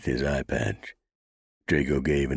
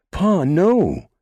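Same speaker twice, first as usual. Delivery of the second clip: yelling; 10 dB louder than usual. A narrator's reading. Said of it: "Banty yelled".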